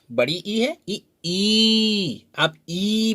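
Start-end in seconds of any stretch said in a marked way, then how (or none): 0.9-2.2 ई held long each time; teacher models a long ई. Shown as a demonstration. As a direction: hold the vowel ई. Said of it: "देर तक इसे बोलेंगे".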